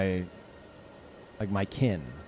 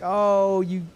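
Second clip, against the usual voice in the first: doting on a child, hammed up; talking to a child; 5 semitones higher than usual. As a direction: gush over an innocent little one.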